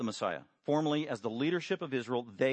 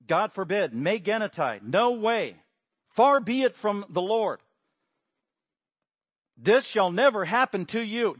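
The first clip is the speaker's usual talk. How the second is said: louder, higher indignant rebuke of "This shall never happen to you"; Peter rebuking; 5 semitones higher, 6 dB louder than usual. Acted. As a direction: refuse it with outrage. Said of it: "he is just laying into Jesus".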